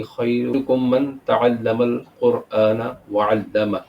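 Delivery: slow reverent Arabic recitation; hadith recitation. Acted. (حدیث کی تلاوت)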